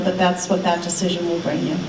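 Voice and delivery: normal talking voice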